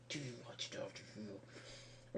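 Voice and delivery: slow whispered repeat; hushed, slow conspiratorial whisper; whispered aside. Real, not acted. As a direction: whisper it to yourself, slowly. Repeated question, second whispered.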